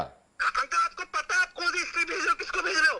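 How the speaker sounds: very high, frantic protest; real panic. Real, not acted. Victim asking uncle which history he is sending, panicked. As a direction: plead urgently, voice pitched right up.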